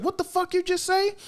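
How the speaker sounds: high-pitched mock fury; Yusuke, furious. Yusuke reacting to bad news, high mock fury. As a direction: squeak it out in comic outrage. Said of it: "Yusuke got furious".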